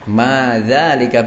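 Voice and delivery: Ma dzalika slowly, th-sound dh clearly voiced; model reading. Shown as a demonstration. Teacher models the correct reading, slow and deliberate. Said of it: "betul, bacanya seperti itu".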